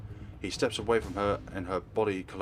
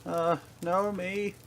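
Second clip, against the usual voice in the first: slow, higher call through smoke; strained call; 9 semitones higher, much slower than usual. Acted. He calls Naomi through the smoke, strained and choked. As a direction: force the name out with a burning throat.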